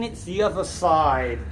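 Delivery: ordinary delivery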